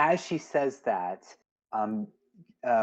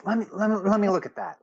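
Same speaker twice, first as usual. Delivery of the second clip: eager, much higher-pitched character voice; Stella; 10 semitones higher, much faster than usual. Acted. Stella asks to see the note, eager and high. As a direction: pitch it up, stumbling into the request. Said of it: "Stella takes the note from you and goes".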